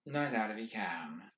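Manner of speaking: low, soft and detached; clinical. A narrator's reading. McVries answering Garrity, cool and clinical. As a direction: state it coldly. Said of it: "McVree said clinically".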